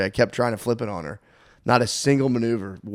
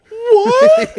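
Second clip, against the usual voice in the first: high, loud stunned "What?"; a little girl's hurt disbelief; much higher, louder cry of outrage; shocked shout; 11 dB louder than usual. Retold or imitated. Daughter told she's boring.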